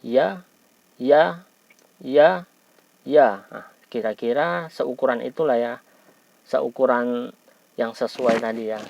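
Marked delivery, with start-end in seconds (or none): 0.0-3.3 repeated "ya", jaw lowered, louder; over enunciating. Shown as a demonstration. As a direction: drill "ya" slowly with a wide jaw. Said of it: "sambil berlatih kita ucapkan ya".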